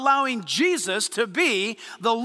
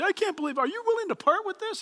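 eager buyer; 7 semitones higher, 4 dB quieter, faster than usual. Retold or imitated. Garage sale buyer voiced.

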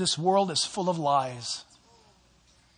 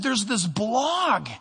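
higher, urgent caller breaking bad news; higher, urgent worried caller; worried board member; 6 semitones higher than usual. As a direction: voice the anxious friend on the phone, pitched up. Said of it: "And he said".

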